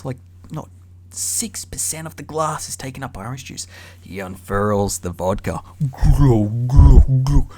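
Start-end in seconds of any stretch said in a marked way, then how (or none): 5.9-7.5 loud mock glug glug; gurgling bottle sound effect; glugging pour noise. Mimics vodka pouring.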